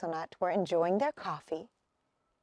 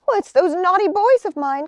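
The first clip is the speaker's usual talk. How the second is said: higher, louder cross mother; complaining; 8 semitones higher, 8 dB louder than usual. Acted. Missus Acorn complaining to her friend about her sons, cross and loud. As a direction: fume about the naughty boys. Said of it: "complained Missus Acorn".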